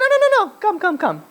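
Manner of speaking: high-pitched mock insistence; insistent urging. Stranger urging the man to come, acted high insistence. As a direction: hurry him along, pitched well up.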